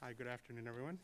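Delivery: soft greeting too far from the microphone; quiet, far from mic. Real, not acted. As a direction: speak softly, away from the mic. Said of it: "Can you pull the mic a little closer?".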